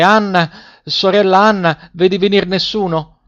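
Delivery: high narrated call to someone above. A narrator's reading. Wife to sister Anna on the tower, calling out. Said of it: "e poi tornava a chiamare".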